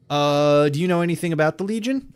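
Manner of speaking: hurried first try at the line; botched rushed take. Shown as a demonstration. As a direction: blurt the question out fast. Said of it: "let me redo that".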